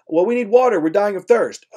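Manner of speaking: mock desperate cry for water; pleading sailors. Distressed sailors begging the shore for water, hammed-up.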